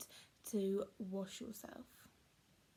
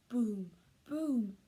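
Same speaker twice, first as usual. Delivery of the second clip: ordinary delivery, nothing marked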